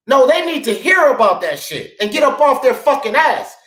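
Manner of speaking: ordinary delivery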